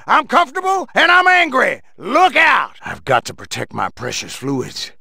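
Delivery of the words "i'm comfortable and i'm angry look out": angry shout. Acted. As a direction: blurt it out furious and high. General ranting about going commando.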